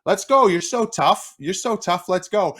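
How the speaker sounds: high and loud mocking dare; taunting dare. Ref daring player to fight, loud high taunt. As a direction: egg him on, high and loud. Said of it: "he's like".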